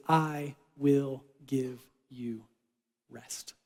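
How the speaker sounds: slow, soft promise of rest; quietly reassuring, each word slow; tender promise. Pretend. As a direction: hush it like comfort.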